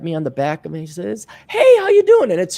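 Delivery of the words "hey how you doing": very high, loud chirpy hello; high-pitched, loud retold hey-there; mock breezy greeting. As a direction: imitate an over-friendly surprise greeting. Her sudden greeting at the bar.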